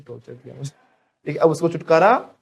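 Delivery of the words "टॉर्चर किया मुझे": softly imitating her complaint; a hushed, mimicked grumble; quiet mock complaint. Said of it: "बेचारी कहती थी". (धीमी आवाज़ में नकली शिकायत)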